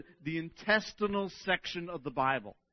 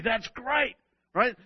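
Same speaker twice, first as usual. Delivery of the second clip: ordinary talk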